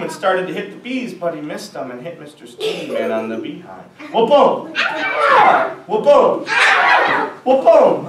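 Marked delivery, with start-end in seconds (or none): 4.1-8.1 louder, punchy sound-effect boom; shouted cartoon boom; loud comic boom. A narrator's reading. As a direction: bang it out. Broom hits, repeated gag.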